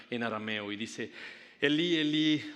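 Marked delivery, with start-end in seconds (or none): 1.6-2.5 exclamación actuada, alta y fuerte; a gran voz. Acted. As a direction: clama como Jesús en la cruz. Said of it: "Jesús exclamó a gran voz". (clamor a gran voz)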